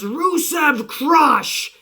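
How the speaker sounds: slow, drawn-out mock yell; retold scream. Aiden English on the apron, screaming a slow chant. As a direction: stretch the chant out like a hype man. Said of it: "he just screams".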